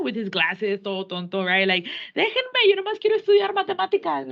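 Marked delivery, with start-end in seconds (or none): 2.2-4.3 high whiny nerd pleading to be left alone; nerdy kid voice. Retold or imitated. Teacher voicing a bullied nerdy kid to the class, high whine. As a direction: squeak it out like a scrawny bookworm begging.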